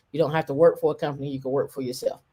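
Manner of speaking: fast, hurried delivery; rushing. Real, not acted. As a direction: rattle it off quickly. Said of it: "I'm rushing for a reason".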